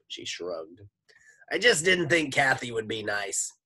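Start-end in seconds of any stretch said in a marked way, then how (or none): 1.5-3.5 high, loud cartoon lunch lady; Miss Mush. A narrator's reading. Lunch lady steadies the class, high loud holler. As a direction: call out in a sloshy high lady voice. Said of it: "let me do Miss Mush's voice".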